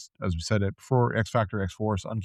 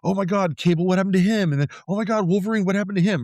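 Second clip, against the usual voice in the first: excited reader; 7 semitones higher, faster than usual. Retold or imitated. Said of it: "it ends on this cliffhanger".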